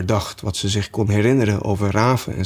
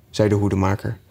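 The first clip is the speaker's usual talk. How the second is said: plain reading voice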